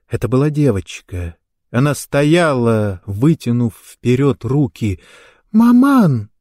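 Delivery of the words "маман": put-on high, loud child's call; high childlike cry; tender child's voice. A narrator's reading. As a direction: call out as a small child to mother. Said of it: "произнёс нежный детский голосок".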